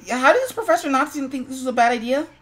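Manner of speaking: normal talking voice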